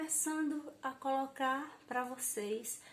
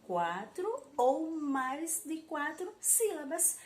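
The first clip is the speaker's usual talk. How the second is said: quatro ou mais de quatro sílabas spoken slowly and higher, quatro stressed; slow emphatic rule; 5 semitones higher, 4 dB louder, slower than usual. Shown as a demonstration. Teacher to pupils, repeating the rule: slow, higher emphasis. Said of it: "É por isso que diz assim".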